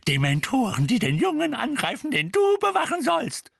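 high scolding rising in pitch; furious screeching. A narrator's reading. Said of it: "kreischte Misses Figg".

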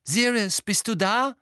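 loud, high searching call. A narrator's reading. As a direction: call out to see if anyone is there. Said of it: "rief er".

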